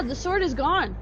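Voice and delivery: ordinary talk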